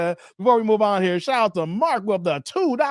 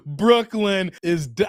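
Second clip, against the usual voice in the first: normal talking voice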